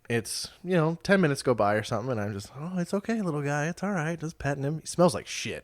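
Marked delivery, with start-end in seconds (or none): 2.5-4.2 soothing the kitten. Retold or imitated. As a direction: comfort the little guy gently.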